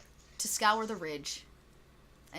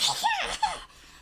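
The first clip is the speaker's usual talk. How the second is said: goblin cackle; 6 dB louder than usual. Acted. Goblin startled by hot ash.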